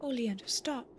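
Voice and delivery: urgent hushed whisper. Acted. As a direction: breathe it out under your breath. Urgent game line whispered.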